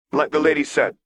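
put-on high, grinning boy; teasing kid voice; young boy voice. Echo teases Lux.

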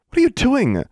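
high, loud startled cry; shocked exclamation. A narrator's reading. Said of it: "Rebecca exclaimed".

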